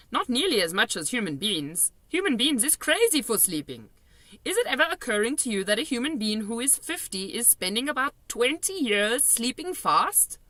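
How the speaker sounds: put-on excited giant lecturing a girl; BFG giant voice. A narrator's reading. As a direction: explain it like a giddy old giant. BFG voiced in read story.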